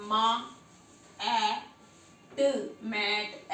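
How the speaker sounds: teacher sounding out each letter slowly; phonics sounding out. Shown as a demonstration. Teacher shows child phonics, sounding out. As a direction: sound out मा, ए, ट then blend into मैट. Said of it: "ऐसे पढ़ना है".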